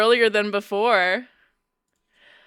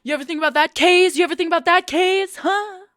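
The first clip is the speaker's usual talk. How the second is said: high-pitched, goading repetition; confrontational taunting; 8 semitones higher than usual. Real, not acted. Streamer confronts viewer about phone settings, high taunt. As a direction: press them with a high, needling challenge.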